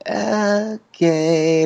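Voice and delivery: high squeaky mock reply; a touch of awkward retreat; sheepish okay. Retelling their awkward reply to the meeting, high and squeaky. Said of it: "we're just like".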